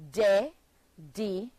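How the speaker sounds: dedicated said slowly, syllable by syllable; slow syllable demo. Shown as a demonstration. Teacher showing the class, counting syllables slowly. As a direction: stretch out dedicated so each syllable lands.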